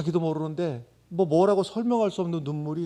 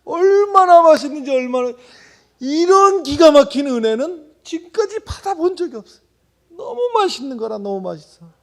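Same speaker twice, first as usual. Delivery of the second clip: high, loud, fired-up preaching voice; fervent preaching; 12 semitones higher, 12 dB louder than usual. Acted. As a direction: preach it with full-throated excitement. Preaching, retelling the pig's delight. (열정적인 설교 목소리)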